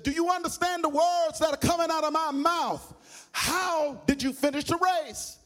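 big frogs grilling the little frog, exasperated; belligerent demand. Acted. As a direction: demand an answer, slowing on the last words. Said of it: "They got louder and louder and more belligerent".

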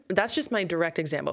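ordinary talk

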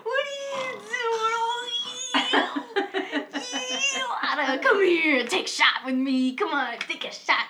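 lightly played cheerful drunk; happy drunk. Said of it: "here's that drunk".

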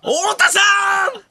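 high, hammed-up wail of a name; desperate mock prayer. Comedian prays veteran holds back, high mock-desperate cry. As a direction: cry out as if begging the heavens. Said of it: "神に祈ったもんな".

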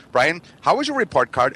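a mother needling her son about grades; mom voice. Retold or imitated. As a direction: mimic mom's pointed question. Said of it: "she'd be like".

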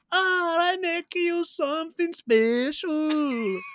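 put-on high, loud, drawn-out muddled Italian-Polish accent. Podcast host mimics the restaurant owner in the film, muddled Italian accent. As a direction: ham up a confused Italian-ish accent, stretched and high. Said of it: "don't even know what accent he's doing".

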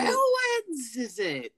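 very high, drawn-out whine; hammed-up creature wail. Creature lamenting ruined rabbits, slow high wail. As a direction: stretch it out in mock despair. Quoting movie creature's complaint.

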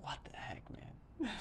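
mock whispered disbelief. Reacting to a friend's mixed-up word, whispered. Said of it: "And I was like".

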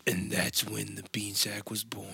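hushed, unvoiced movie-trailer line; dramatic whisper. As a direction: whisper it like a legend's origin.

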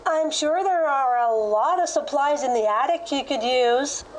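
cheerful. Acted. Foster mom overhearing kids from the kitchen, cheerful. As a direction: chime in helpfully, voice lifted. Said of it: "said cheerfully as she overheard them".

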